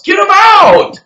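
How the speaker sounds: high, forceful outburst; shouted command. Real, not acted. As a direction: yell it as an urgent order. Ranting command to remove junk food.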